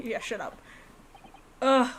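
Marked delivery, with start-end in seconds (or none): none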